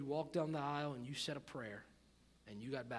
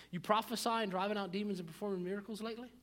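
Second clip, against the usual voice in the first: usual speaking voice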